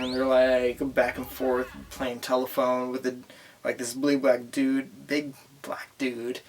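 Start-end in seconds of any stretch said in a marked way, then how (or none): 5.1-6.4 a conspiratorial whispered aside. Shown as a demonstration. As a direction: drop to a hush on the repeat. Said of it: "repeat of previous".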